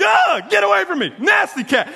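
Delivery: high, loud, squealing at the cat; overdramatic yell. Retold or imitated. Dad recoiling from the purring sewer cat, overdramatic yelling. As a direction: freak out at the cat rubbing your leg. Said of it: "I'm overdramatic about everything".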